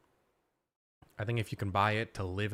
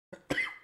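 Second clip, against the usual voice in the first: hard dry cough; coughing fit. Real, not acted. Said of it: "Sorry I'm coughing so much".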